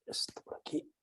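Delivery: very soft, whispered aside to self; barely audible self-talk; muttering to self. Real, not acted. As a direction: murmur it under your breath while setting up. Talking to self while setting up.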